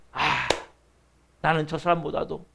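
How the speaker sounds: low, hushed self-reproach; humble confession. Acted. Believer confessing own fault to God, humbly subdued. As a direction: open with a sigh, low and subdued.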